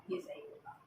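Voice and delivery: a soft breathed hint to the class; whispered aside. Shown as a demonstration. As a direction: whisper it softly. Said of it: "ठीक है, तो ये आपका". (फुसफुसाकर)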